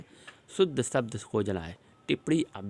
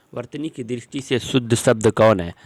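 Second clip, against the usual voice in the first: loud question; 9 dB louder than usual. Real, not acted. Teacher calls out the question.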